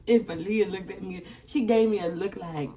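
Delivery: usual speaking voice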